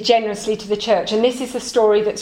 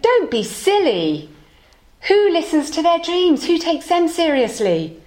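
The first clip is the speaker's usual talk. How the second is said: higher, dismissive scoff; scoffing shopkeeper; 7 semitones higher than usual. Acted. Shopkeeper mocks the tinker for trusting dreams, light scoff. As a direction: brush the idea off with mild scorn.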